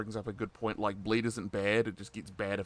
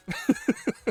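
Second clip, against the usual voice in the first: very high laugh, louder; real laugh; 6 dB louder than usual. Real, not acted. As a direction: burst into a laugh at yourself. Laughs at own enthusiasm.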